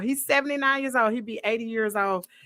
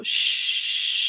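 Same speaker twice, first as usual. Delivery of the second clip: mock shush. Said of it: "I hear some go".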